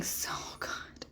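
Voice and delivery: breathy whispered aside, no voice at all. Real, not acted. Whispering to followers how good Cancers are. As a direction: whisper it like a secret compliment. Gushing about Cancer energy.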